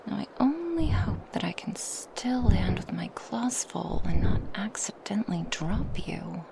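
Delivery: usual speaking voice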